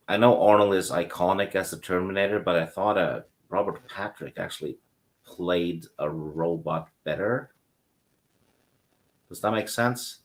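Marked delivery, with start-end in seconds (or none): none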